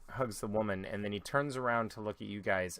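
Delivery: normal talking voice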